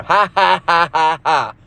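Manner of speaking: spoken ha ha mocking; mocking spoken laugh; mock laughter. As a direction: laugh at them scornfully. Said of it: "It's a laughing matter".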